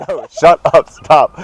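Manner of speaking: loud mock-exasperated cutoff; retold fed-up coach raising his voice; exasperated outburst. Coach's retold reaction to teasing.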